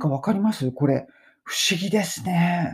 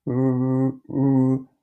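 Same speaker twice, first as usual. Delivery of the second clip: throaty growl on う; a growling う sound; 4 semitones lower than usual. Shown as a demonstration. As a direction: rumble う deep in the throat. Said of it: "うなってもいいし".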